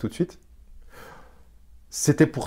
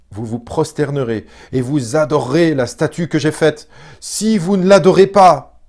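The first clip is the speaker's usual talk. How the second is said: loud, raised-pitch royal threat; roi furieux qui crie; 4 semitones higher, 9 dB louder than usual. Acted. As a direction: thunder the ultimatum at those who disobey. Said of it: "tellement énervé et irrité qu'il". (roi furieux, menaces criées)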